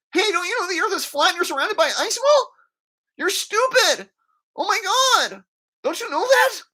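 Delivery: very high, loud, squealed berating; mocking impression of Joshua. Kid berating people on the streets, high mocking voice. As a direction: squeal it like an obnoxious street heckler. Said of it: "That's how he sounds, I swear to God".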